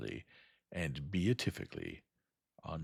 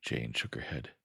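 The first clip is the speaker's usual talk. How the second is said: plain reading voice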